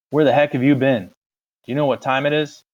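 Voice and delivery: an angry brother demanding answers; angry older brother. Acted. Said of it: "He was madder than I'd seen him".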